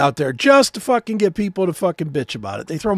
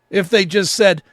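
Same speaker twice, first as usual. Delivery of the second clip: ordinary talk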